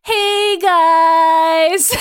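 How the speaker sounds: lightly acted, high drawn-out "hey"; mock casual greeting. Magneto comes back after destroying his island, mock casual greeting.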